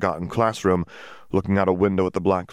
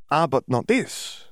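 Dimitri's voice; 8 semitones higher, slower than usual. A narrator's reading.

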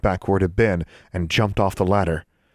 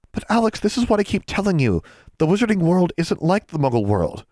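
put-on higher girl voice, urging; earnest girl; 11 semitones higher than usual. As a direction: insist to a stubborn friend. Anna explains in girl voice.

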